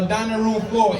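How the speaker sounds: normal talking voice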